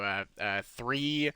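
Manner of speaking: ordinary delivery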